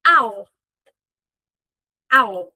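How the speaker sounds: "I'll" said as one syllable ending in a clear L; contracted I'll. Shown as a demonstration. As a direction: clip I will down to "I'll". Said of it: "ele vai ser usado como".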